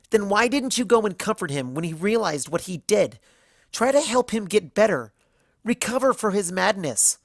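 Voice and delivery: high, loud accusing questions; angry questioning. A narrator's reading. Twilight demanding answers from Celestia, angry and loud. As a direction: hammer her with each question. Twilight confronting her mentor.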